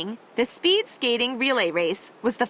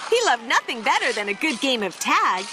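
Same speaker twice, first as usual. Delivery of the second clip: ordinary delivery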